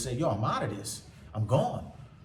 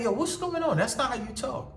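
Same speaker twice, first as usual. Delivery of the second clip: puzzled friends; 12 semitones higher than usual. Retold or imitated. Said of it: "People like".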